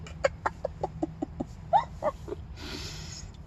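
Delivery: high, airy laugh with no voice; breathless silent laugh. Real, not acted. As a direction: laugh until only breath comes out. Laughing at own pun.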